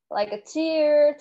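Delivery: "cheer" slow and drawn out; ch sound for T. Shown as a demonstration. Teacher modelling "cheer" slowly for students. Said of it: "छा consider करना है".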